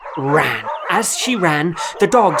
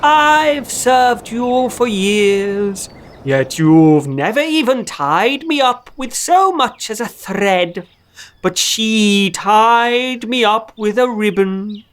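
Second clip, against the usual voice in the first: higher, slow tree voice; birch tree answering; 5 semitones higher than usual. A narrator's reading. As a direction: speak like a gentle old tree. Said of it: "And the birch tree said".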